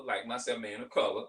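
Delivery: ordinary talk, nothing marked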